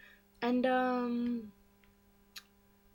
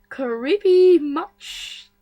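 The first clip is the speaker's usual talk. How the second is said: slow, loud, drawn-out sarcasm; sarcastic drawl; 6 semitones higher, 13 dB louder, much slower than usual. Real, not acted. Vlogger mocking the creepy story scene, slow sarcastic drawl.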